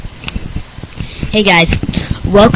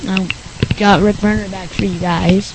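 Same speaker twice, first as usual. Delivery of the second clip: ordinary talk, nothing marked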